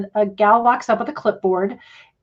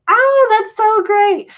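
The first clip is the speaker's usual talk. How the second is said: real excited squeal; 13 semitones higher, 5 dB louder, slower than usual. Real, not acted. Excited reaction to viewer comment.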